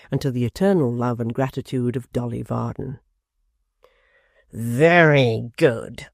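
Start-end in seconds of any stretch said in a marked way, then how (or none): 4.5-6.1 very slow, lofty declaiming; pompous apprentice. A narrator's reading. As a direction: make every word weighty. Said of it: "said Mister Tappertit".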